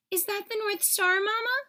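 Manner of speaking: put-on high, eager little-girl voice; young reindeer child. A narrator's reading. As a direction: ask it like a wide-eyed young fawn.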